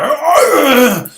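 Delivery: loud high anguished cry of rage; angry cry. Retold or imitated. Trapped in a coffin, crying out in rage. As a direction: let out a trapped, furious wail. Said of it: "I was all angry".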